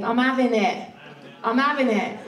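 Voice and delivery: put-on "I'm having it" in a light South London accent, dropping the H; a hint of a South London accent, "'avin' it"; lightly imitated South London voice. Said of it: "Stewie says it best in his South London accent".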